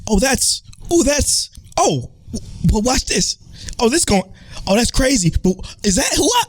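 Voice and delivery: very high-pitched gushing imitation; mocking falsetto running commentary; mock excited moviegoer. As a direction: squeal out breathless one-liners like an annoying film fan. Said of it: "he just saying all these fucking one-liners".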